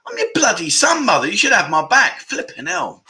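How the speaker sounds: high-pitched playful telling-off of mum; hammed-up betrayed son scolding his mother; mock indignant. Real, not acted. Mock outrage at mum's comment.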